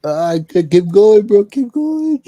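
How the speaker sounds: high loud cracking laughter; laughing. Real, not acted. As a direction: squeal through laughter. Laughing hard at the acting.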